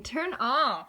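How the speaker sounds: ordinary talk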